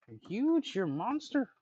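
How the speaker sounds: squeaky-high mock amazement at a dog; teasing pet talk. Real, not acted. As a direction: pitch shoots way up, playfully astonished. Teasing the dog about its size.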